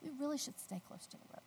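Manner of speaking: husband calmly warning his wife to stay near; calm, soft. Retold or imitated. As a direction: soothe her with a quiet steady voice. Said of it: "he's being very calm".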